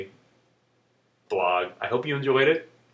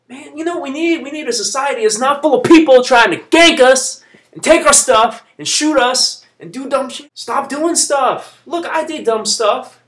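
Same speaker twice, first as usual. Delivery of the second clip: loud, heated outburst at society; furious shouting rant; angry rant; 9 semitones higher, 9 dB louder than usual. Real, not acted. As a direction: vent the fury full force. Ranting about crime victims blamed.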